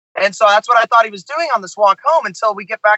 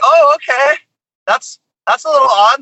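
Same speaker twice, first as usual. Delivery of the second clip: higher, louder startled "oh, okay"; awkward surprise; 6 semitones higher, 3 dB louder than usual. Retold or imitated. Band reacting to fans showing up, higher startled unease. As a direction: caught off guard, politely uneasy. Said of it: "We were like".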